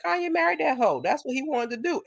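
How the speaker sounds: imitating Cat. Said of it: "he was like".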